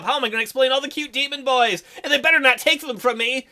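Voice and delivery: ordinary delivery, nothing marked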